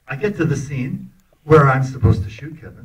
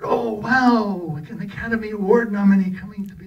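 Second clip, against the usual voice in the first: higher, excited mock admiration; mock gushing; 9 semitones higher than usual. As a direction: gush like a star-struck director. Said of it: "and he said".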